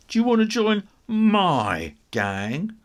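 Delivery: slow, loud pushy offer; looming bully. A narrator's reading. Tiddles pressing Muncher to join, slow and loud. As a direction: lean on him with the question.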